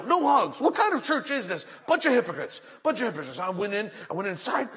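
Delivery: acted outraged churchgoer, pitch climbing; huffy indignation. Woman complaining to her pastor about no hugs, huffy. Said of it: "all huffy and puffy".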